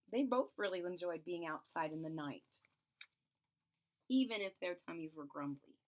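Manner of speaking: ordinary delivery, nothing marked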